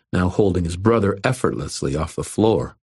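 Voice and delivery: ordinary delivery, nothing marked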